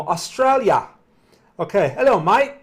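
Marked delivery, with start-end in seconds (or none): 1.6-2.6 put-on a light, playful Australian accent, a bit higher and louder; mock Australian accent. Streamer greets an Australian viewer in a hammed-up Australian accent. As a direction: greet him like a cheery Aussie, lightly put on. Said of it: "That's my, my Australian accent for today".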